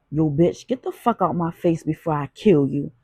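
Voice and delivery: plain reading voice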